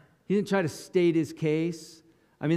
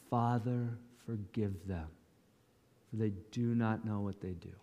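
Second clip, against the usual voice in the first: soft, hushed prayer to the Father; tender plea; 5 semitones lower, 9 dB quieter than usual. Retold or imitated. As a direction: lower and soften, pleading mercy. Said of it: "what's the first thing he says".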